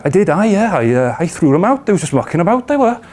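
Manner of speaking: put-on lilting Cardiff accent, jumping high at the end. Cardiff dealer explaining he threw the lads out, Cardiff accent. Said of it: "I'm about to do a Cardiff accent".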